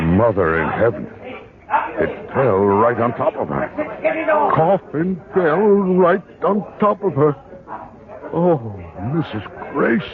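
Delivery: loud, rising horrified cry; horrified shock. Acted. Jeffrey cries out after the coffin crushes the housekeeper, horrified. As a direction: cry out in disbelief at the accident.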